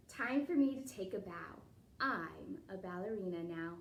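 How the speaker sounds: lilting storybook read-aloud; reading to children. A narrator's reading. Teacher reading a ballet picture book to the class, lilting read-aloud. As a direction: read the rhyme to the kids with a bounce.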